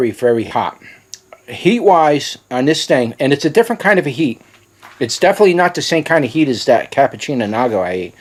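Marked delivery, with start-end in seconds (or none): none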